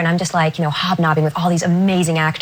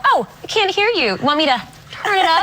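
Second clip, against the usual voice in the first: teasing call; 8 semitones higher than usual. Retold or imitated. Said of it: "And I go".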